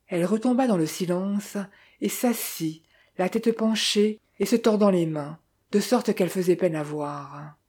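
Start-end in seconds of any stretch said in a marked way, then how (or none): none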